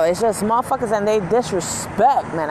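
ordinary talk, nothing marked

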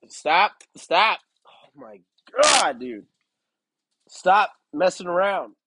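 real frustration at restless dogs; exasperated scolding. Real, not acted. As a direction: scold the misbehaving dogs loudly. Scolding dogs interrupting the recording.

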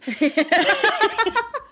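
bursting into a high, rising laugh; a real, high amused laugh. Real, not acted. Laughing about eating so much pizza.

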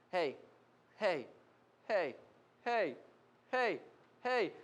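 repeated nagging heys, evenly spaced; insistent little pokes of sound; pestering. Acted. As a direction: pester someone for attention, over and over.

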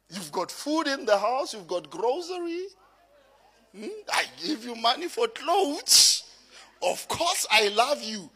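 indignant husband listing what he provides, pitch climbing; exasperated, acted self-justifying retort; defensive reply. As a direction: argue back in rising protest, piling up the proof. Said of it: "So a wrong reply will be".

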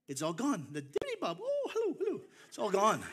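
swooping, loud teasing with falsetto hellos; mock lament. Relatives back home ribbing him about his lost Irish accent, mock lament. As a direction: tease with hammed-up high-pitched swoops.

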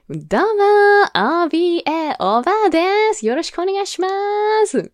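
high, loud showbiz greeting; hammed-up comedian intro. Introducing herself as the granny to coworkers, hammed-up showbiz cheer. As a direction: play along full tilt like a stage comic. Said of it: "全部乗っかっちゃって".

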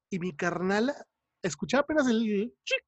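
ordinary delivery

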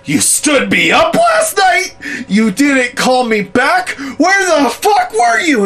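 high, loud, slow accusing shout; mock scorned lover yell. Mom pretending the boss stood her up, yelling at him. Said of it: "yelling at him".

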